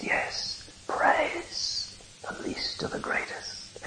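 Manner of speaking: slow high whisper; whispered voice from heaven. A narrator's reading. Preacher voicing God's call from the throne, slow whisper. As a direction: breathe it down from the throne. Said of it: "the voice from the throne of God called down".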